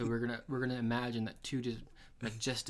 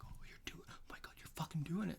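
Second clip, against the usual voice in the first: whispered, much softer, hushed thrill; excited whisper; 6 semitones higher, 8 dB quieter than usual. Retold or imitated. Cousins whispering in the blind as turkeys answer, excited whisper. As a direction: keep it hushed so the turkeys don't hear.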